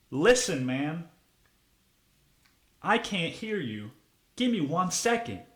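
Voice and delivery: strained restraint, acted out; pointed interruption. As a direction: stop a customer mid-order, softly firm. Imagined confronting customer over baby's crying.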